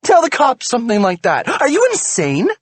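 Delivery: high, loud furious demand; furious question. A narrator's reading. Wife furious husband told the cops. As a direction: demand it in fury.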